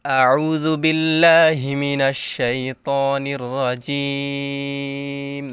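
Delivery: slow, drawn-out recitation; Quran recitation. Acted. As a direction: chant it slowly and devoutly. Recites before Quran lesson.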